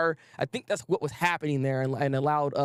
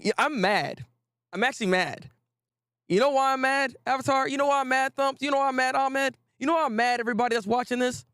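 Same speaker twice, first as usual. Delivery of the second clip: mock mad; 8 semitones higher than usual. Playful mock anger at viewers.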